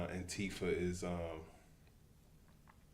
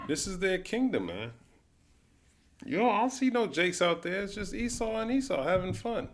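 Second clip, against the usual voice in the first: mocking rant; 12 semitones higher, 5 dB louder than usual. Real, not acted. Mocking the scene.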